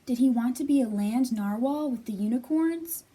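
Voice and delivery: ordinary delivery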